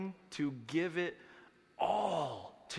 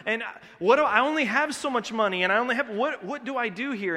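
higher and louder, fretting over money; torn inner voice; 8 semitones higher, 8 dB louder than usual. Retold or imitated. Churchgoer torn between a need and his own money, fretting aloud. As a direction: voice the worried believer, pitched up and pushed. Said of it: "we're like".

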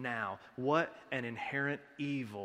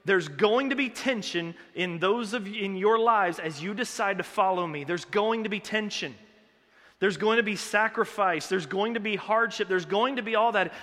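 higher and louder, building insistence; urgent proclamation; 5 semitones higher, 6 dB louder than usual. Retold or imitated. Jesus warning his followers that tension will come, urgent and loud. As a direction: hammer each warning home. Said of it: "Jesus is saying".

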